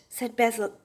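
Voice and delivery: ordinary delivery, nothing marked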